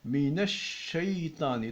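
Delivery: "minash shaytani" slow, shin held long; slow doubled shin. Shown as a demonstration. Teacher to students, "minash shaytani" slow with the shin held. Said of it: "la lettre shin qui est doublée".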